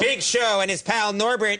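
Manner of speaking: usual speaking voice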